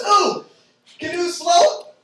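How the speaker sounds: high, loud eager guess; squealed know-it-all guess; mock eager coworker. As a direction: blurt it out like a keen know-it-all. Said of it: "one of the other servers goes".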